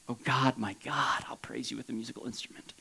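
low, soft, whispery devotion; hushed prayer. Acted. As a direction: drop into an intimate whispered prayer. Psalmist's intimate prayer of praise.

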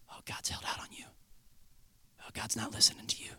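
whispered lie in the ear; insidious whisper. Acted. The enemy convincing you God has held out, whispering. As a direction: whisper it slyly, planting doubt. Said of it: "the whispers of the enemy".

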